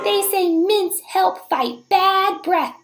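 plain reading voice

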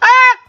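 short, loud, high shriek; panicked scream. Real, not acted. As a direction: yelp in panic. Creeper close by.

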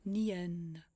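usual speaking voice

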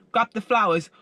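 plain reading voice

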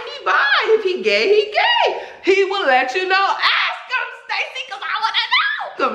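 high, laughing push to ask the man; laughing high-pitched pep talk to a friend; excited urging. Retold or imitated. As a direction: egg her on, giggling and squealing.

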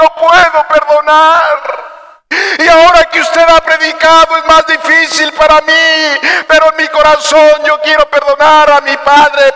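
high, loud, pleading cry of a grown man; tearful plea. Retold or imitated. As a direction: beg the pastor through tears. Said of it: "empezó a llorar y dice".

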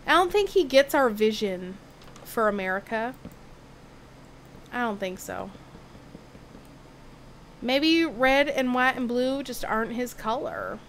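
usual speaking voice

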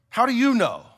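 put-on high, loud boy's challenge; startled demand. A narrator's reading. Shasta questioning the voice in the dark, startled demand. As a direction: pitch it up in sharp disbelief.

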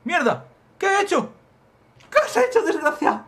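very high, loud panicked cry; horrified shouting. Acted. Realizing someone was shot, horrified shout. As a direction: cry out aghast at the disaster. Acts horror after gun goes off.

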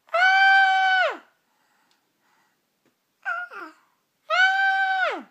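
high piercing shriek; nervous mom scream. Shown as a demonstration. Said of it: "Como é que a mamãe faz?".

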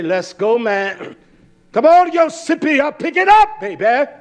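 high, very loud work-crew holler; rallying work shout. Acted. Boss urging on his young ox at work, rallying shout. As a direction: call out to the crew over distance, full voice. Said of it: "I talk like that out there".

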